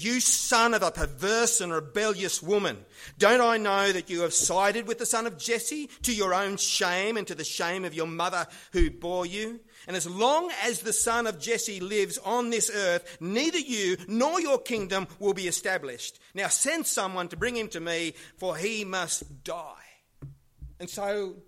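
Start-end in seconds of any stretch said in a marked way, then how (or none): 0.0-18.1 loud, raging retold outburst; angry rant. Saul rages at his son Jonathan, loud angry shout. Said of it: "His anger flared up and he said". 18.4-19.8 slow threat. Retold or imitated. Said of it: "His anger flared up and he said".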